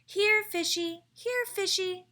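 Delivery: high, slow sing-song call. A narrator's reading. Amelia Bedelia calling to a fish in the water, high sing-song call. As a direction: coax the fish like calling a pet, high and drawn out. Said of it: "she called".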